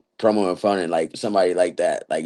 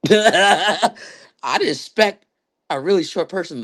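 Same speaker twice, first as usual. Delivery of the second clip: retold laugh through the words, pitched higher; 11 semitones higher than usual. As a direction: giggle out the line as the coach. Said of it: "he just started busting out laughs".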